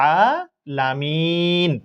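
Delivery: আলামিন drawn out, ending on a held n; slow আলামিন with no final vowel; pausal stop. Shown as a demonstration. Teacher repeats the waqf reading আলামিন for students. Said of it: "এইভাবে পড়ব".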